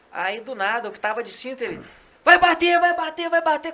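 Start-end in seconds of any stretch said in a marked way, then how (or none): 2.2-3.7 high, loud yell imitating a friend's panic; retold panicked scream. Narrator mimics friend reliving the crash, high panicked shouting. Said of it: "e gritando, gritando".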